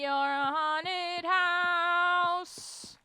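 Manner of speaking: slow eerie high drawl; spooky slow voice. Acted. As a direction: draw it out like a ghost. Drawn-out spooky line.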